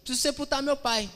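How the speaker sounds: high, loud, hurried excuse-making; acted eager follower. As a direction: play a keen follower begging a minute's delay. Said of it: "Aí vem outro e fala assim".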